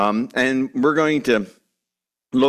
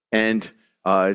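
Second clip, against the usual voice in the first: usual speaking voice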